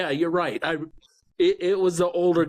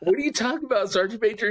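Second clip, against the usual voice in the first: mock surprise; 8 semitones higher than usual. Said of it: "I said".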